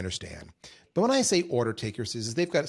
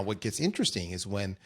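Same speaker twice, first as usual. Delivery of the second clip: usual speaking voice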